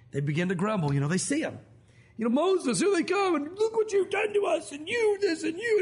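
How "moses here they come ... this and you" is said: high whiny crowd voice, pitched up; mock the grumbling people, whining; hammed-up whiny Israelites; complaining crowd.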